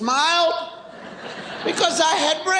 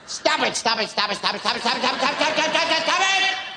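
tobacco auctioneer; much faster than usual. Acted. Parent telling child to stop, auctioneer rattle. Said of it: "Sound like a tobacco auctioneer".

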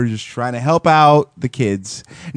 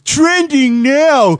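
high, drawn-out segment intro in caricature; slow, high doofus announcer; Ryan; 9 semitones higher, 3 dB louder, much slower than usual. Acted. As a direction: stretch it out in a pitched-up mocking co-host voice. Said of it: "I'll do my best Ryan impression".

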